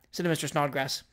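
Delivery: plain reading voice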